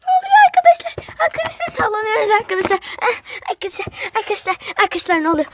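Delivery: high, fast, panicked shouting. Acted. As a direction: yell in alarm as everything shakes. Everything shaking, panicking.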